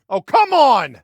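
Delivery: loud, high cry of frustration; indignant outburst; exasperated protest. Acted. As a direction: yell it after someone running off. Protesting being left behind.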